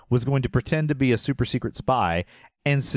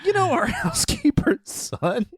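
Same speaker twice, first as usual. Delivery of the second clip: much higher, mock wife's voice; Maria Shriver; 13 semitones higher than usual. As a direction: play the stunned wife, pitched way up. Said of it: "how did Maria Shriver not go".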